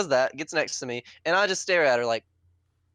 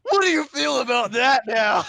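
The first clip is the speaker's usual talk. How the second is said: louder, higher, slow gloating taunt; 10 semitones higher, 8 dB louder, slower than usual. Retold or imitated. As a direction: rub it in his face. Taunting kid after snapping crown.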